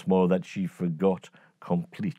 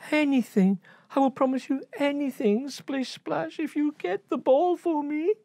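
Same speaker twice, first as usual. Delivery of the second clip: high, fast pleading promise; high rushed princess begging; eager princess. Acted. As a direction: gush the promise quickly.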